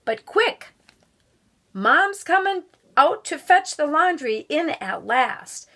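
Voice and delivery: put-on lively read-aloud voice for children; storybook reading. A narrator's reading. Guest reader reading a picture book to schoolchildren, lively storybook voice.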